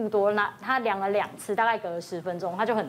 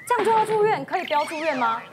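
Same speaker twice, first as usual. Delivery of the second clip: high, startled protest of a worried mom; mock-panicked mother, half laughing; alarmed mother; 6 semitones higher, 4 dB louder than usual. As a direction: gasp out the question in disbelief. Panicked mother resisting hospital stay.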